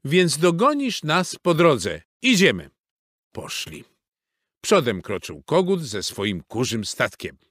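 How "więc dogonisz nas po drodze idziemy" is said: high, lively dog voice; barking dog character. A narrator's reading. Reksio rallying everyone to set off, barking out. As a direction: call it out like an eager dog. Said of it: "zaszczekał Reksio".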